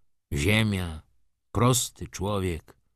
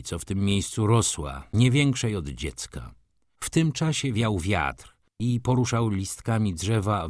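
ordinary delivery

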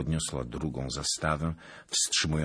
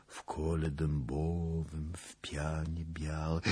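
slow witch chant fading into whisper; drawn-out, whispery conjuring; hushed incantation; 4 dB quieter, slower than usual. A narrator's reading. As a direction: let the spell sink slowly to a whisper.

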